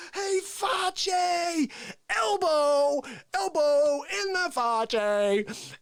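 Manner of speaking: high, loud, slow mock attack yell; hammed-up ambush shout; battle cry. Ambushing Face with an elbow.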